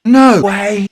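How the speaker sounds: loud high no way; defiant shout keeping the cake; loud taunting refusal. Acted.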